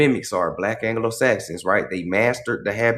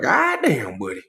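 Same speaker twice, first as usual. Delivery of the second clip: amused disdain. Acted. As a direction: react with amused disdain, pitch jumping up. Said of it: "with amusement and disdain".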